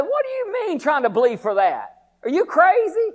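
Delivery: high mocking taunt; preacher voicing the devil scoffing at faith, high and jeering. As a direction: jeer at the believer. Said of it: "the devil said".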